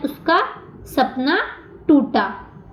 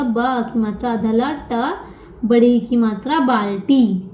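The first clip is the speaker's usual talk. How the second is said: slow, deliberate spelling of "बाल्टी" letter by letter; spelled out slowly; slower than usual. Shown as a demonstration. Teacher spells the word for children, slow and deliberate. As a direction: build "बाल्टी" slowly for the class. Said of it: "आधा ल आता है".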